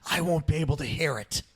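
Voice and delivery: Grinch voice planning slyly, lightly voiced; scheming Grinch plan. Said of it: "Grinch talking to echo".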